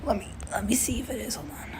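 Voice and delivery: fast, quiet whisper to himself; a whispered aside, rushing. Real, not acted. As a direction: mutter while checking. Checking recording, under breath.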